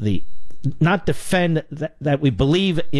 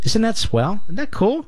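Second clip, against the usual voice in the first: sarcastic; 7 semitones higher than usual. Acted. Mocking news he dislikes.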